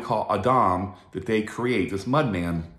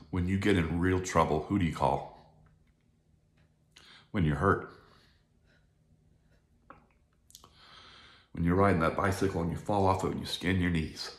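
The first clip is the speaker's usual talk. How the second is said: subdued, lower voice with real emotion; choked up; 4 semitones lower, 4 dB quieter than usual. Real, not acted. Speaker recalling who you call when hurt, choked up. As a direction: push on softly, halting between thoughts. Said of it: "I have a hard time with this".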